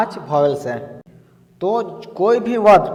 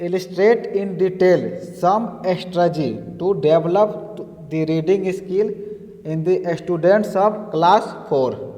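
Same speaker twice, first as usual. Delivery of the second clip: slow dictation; much slower than usual. Acted. Teacher reading an exam question aloud to students, very slow. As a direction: read out very slowly, word by word.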